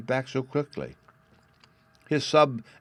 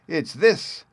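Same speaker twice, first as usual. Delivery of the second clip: high, loud crow of triumph; exultant cry; 9 semitones higher, 5 dB louder than usual. A narrator's reading. Bad boy dumping butter on Sylvie, crying out exultingly. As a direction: gloat like a naughty boy springing a prank. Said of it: "cried the bad boy exultingly".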